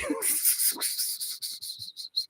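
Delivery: high, airy laugh without voice; breathy high laugh. Real, not acted. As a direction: wheeze out a laugh.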